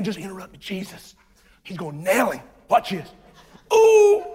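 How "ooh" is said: high, loud crowd ooh; high, loud mock crowd ooh. Crowd reacting to Jesus' rebuke.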